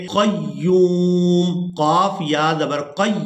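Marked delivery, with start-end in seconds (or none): 0.1-1.7 क़य्यूम spelled out slowly, stopping on साकिन मीम; careful pronunciation. Shown as a demonstration. Teacher modelling the word for students, slow and exact. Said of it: "मिलाकर अदा करेंगे".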